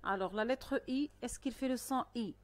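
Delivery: ordinary delivery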